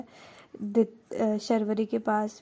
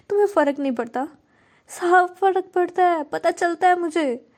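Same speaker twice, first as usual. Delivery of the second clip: higher and louder, an angry confronting outburst; losing her temper; 5 semitones higher, 6 dB louder than usual. Acted. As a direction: fire the questions at her, fed up and hurt. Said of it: "अपना वो खो देती है". (गुस्से में चिल्लाकर सवाल करते हुए)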